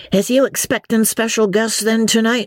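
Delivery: a hint of a curious old countryman; old villager voice. A narrator's reading. Hempseed voiced.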